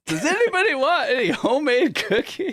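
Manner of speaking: very high, loud mock call; hammed-up high-pitched cookie offer; Connor Johnson. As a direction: squeal it out like an intruder bursting in. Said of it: "bursts open the cafeteria door and just goes".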